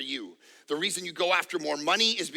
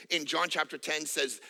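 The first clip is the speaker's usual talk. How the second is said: normal talking voice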